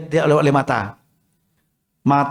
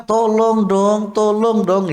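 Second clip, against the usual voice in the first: whining plea; 4 semitones higher, much slower than usual. Shown as a demonstration. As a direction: stretch it out like a child pleading. (memohon sambil merengek pelan)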